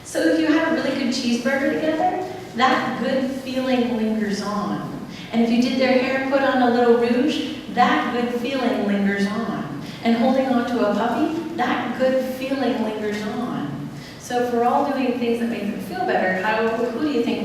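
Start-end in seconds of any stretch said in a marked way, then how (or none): 2.6-5.1 slow and drawn out, each word stretched; slow refrain. Shown as a demonstration. 7.7-9.8 slow and drawn out, each word stretched; slow refrain. Shown as a demonstration. 11.6-13.9 slow and drawn out, each word stretched; slow refrain. Shown as a demonstration.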